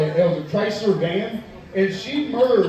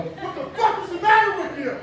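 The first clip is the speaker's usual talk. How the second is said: high, furious yell at a driver; road rage yell; 11 semitones higher than usual. Retold or imitated. Yelling at the driver who cut him off. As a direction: scream it out the window. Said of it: "I start yelling".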